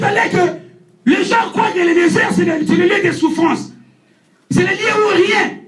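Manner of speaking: sermon delivered with fervour; prédication. Acted. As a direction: preach it, rising and full-voiced. (prêche ardent à l'assemblée)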